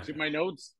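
ordinary talk, nothing marked

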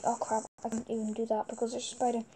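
ordinary delivery

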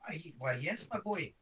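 put-on high Irish lilt on my boy; an old professor's voice that slips into Irish; accidentally Irish accent. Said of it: "Nope, that's Irish".

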